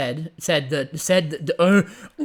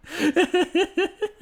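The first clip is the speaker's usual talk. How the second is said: laugh; 14 semitones higher than usual. Real, not acted. Laughing at chat banter.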